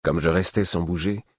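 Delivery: ordinary delivery, nothing marked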